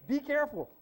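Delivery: high, laughing warning; giggling high warning. Real, not acted. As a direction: laugh it out as a tease. Laughing warning after joke.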